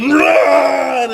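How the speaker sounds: loud, high yell; acted panicked shout. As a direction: shout it as a sudden alarm, mock panic. Acts out sudden panic.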